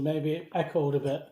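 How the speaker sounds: normal talking voice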